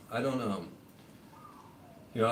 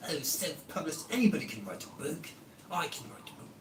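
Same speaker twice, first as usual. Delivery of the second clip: sneering critic; 7 semitones higher than usual. Retold or imitated. Mocking doubters who scoff at his book, high and sneering. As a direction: scoff at the self-published book.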